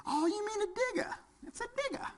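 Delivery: very high, breezy British lady voice; British accent. Retold or imitated. Impersonating the London caller correcting him in a British accent. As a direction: pipe up like a prim British lady. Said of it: "I love her accent".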